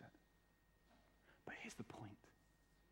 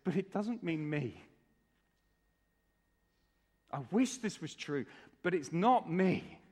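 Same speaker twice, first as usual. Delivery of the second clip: raised, protesting voice of self-doubt; insist, higher, that you are left out; doubting listener; 4 semitones higher than usual. Acted. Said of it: "little part of you that says".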